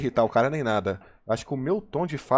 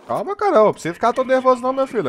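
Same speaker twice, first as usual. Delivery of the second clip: much higher, louder, fast teasing voice; mock calming; 12 semitones higher, 4 dB louder than usual. Teasing an irritated teammate over voice chat, high fast mock-soothing. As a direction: soothe him mockingly, squeaky and quick. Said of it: "Tô zoando com o cara".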